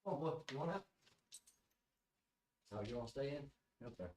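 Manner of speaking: distant voice across the room; calling to the dogs from afar; away from the mic. Real, not acted.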